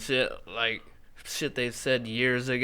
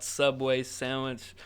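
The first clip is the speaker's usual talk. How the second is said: ordinary talk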